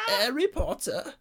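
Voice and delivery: put-on very high, drawn-out British-accented cry; hammed-up, slow high call; mock film villain. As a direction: stretch it out in a silly high villain voice. Echoing the acted film line.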